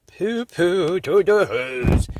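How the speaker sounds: loud, singsong mocking hum; a lightly voiced smug villain; gloating Grinch. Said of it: "he was grinchiously humming".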